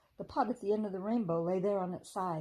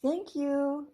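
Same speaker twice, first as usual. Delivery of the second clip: put-on bright thankful child voice; 7 semitones higher, 6 dB louder than usual. A narrator's reading. Children thank deer, bright character voice.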